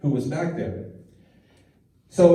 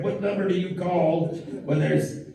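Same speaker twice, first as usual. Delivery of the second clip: Southern-drawl puppet voice, pitched up; Southern dog puppet; 4 semitones higher than usual. Acted. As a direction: puppet show voice with a Southern twang. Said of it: "kind of a redneck Southern drawl".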